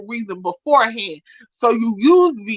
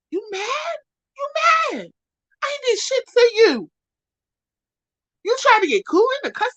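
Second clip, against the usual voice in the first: 1 incredulous taunt; 12 semitones higher than usual. Real, not acted. Incredulous high taunting at April.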